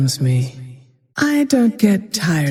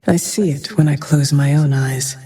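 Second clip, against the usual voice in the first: ordinary delivery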